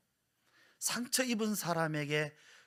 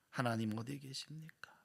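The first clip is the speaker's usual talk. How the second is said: softer whispered plea; whispered prayer; 7 dB quieter than usual. Acted. Sufferer asking where God is, whispered plea. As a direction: breathe the question to God.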